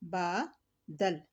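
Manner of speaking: बादल very slowly, "daal" with zabar, not "dil"; slow correct pronunciation. Shown as a demonstration. Teacher slowly models बादल for students. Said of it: "दुरुस्त तलफ़्फ़ुज़ होगा बादल".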